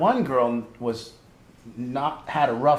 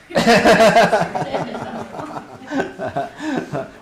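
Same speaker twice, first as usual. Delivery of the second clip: big real laugh, high and loud; laughing at the sister's joke; hearty laugh; 10 semitones higher, 7 dB louder than usual. Real, not acted. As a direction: burst out laughing at the story. Laughing at the story.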